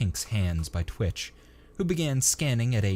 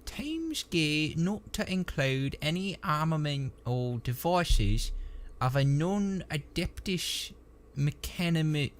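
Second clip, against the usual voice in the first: slow, halting, higher trooper voice; effortful reading; 9 semitones higher, slower than usual. A narrator's reading. Trooper struggling through the data slate's text, laborious reading. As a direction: labour through each hard word. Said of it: "face screwed up with effort".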